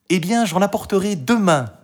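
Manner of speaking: put-on high, obliging girl's voice; Marie. A narrator's reading. Marie promises food for tomorrow, high and willing. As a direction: promise it in a child's voice.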